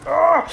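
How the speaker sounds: high, loud effort cry; hammed-up anime effort yell; mock straining yell. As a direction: grunt out the block as a yell. Hero blocking.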